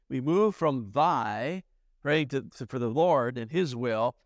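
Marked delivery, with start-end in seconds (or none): 0.1-1.6 slow, leaning on "thy"; "thy" drawn out slowly with weight; stressing the pronoun. Shown as a demonstration. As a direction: dwell on "thy" slowly to point it out. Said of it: "notice how the personal pronouns change".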